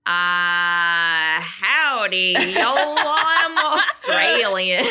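hammed-up imitation of a bad Australian accent; botched Australian accent. Retelling an improv partner's attempt, a bad Australian accent. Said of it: "came up next to me was like".

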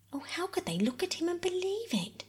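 high-pitched, dismayed outcry; distressed cry. A narrator's reading. Bobby to Mother about Father's conviction, crying out in dismay. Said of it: "cried Bobby".

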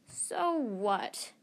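usual speaking voice

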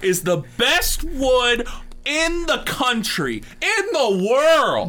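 high, loud, slow hammed-up ad voice; a joking guy hypes fake wood on air, way over the top; over-the-top pitchman. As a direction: sell the wood like a booming TV pitchman.

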